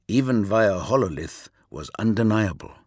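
usual speaking voice

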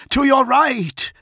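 high, loud, drawn-out warning; strained warning shout, pitched right up. A narrator's reading. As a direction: shout an urgent battle direction. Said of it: "Dantiok shouted".

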